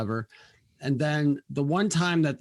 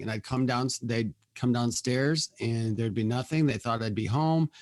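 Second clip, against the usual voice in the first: normal talking voice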